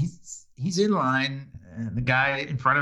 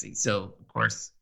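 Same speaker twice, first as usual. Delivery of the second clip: usual speaking voice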